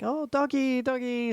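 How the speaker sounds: put-on high kid voice; excited kids. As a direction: squeal it like a little kid. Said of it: "I could hear them from afar, like".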